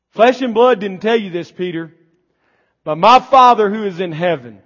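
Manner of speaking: fiery preaching. Acted. Pastor driving home grace to the congregation, fiery. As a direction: preach it to the back row.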